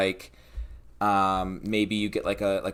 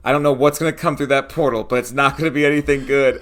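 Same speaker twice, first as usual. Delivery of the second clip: urgent, louder warning, picking up pace; Brome one's warning; 4 semitones higher, 6 dB louder, faster than usual. Acted. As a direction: warn the party as a tense gnome. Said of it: "like, Brome one is like".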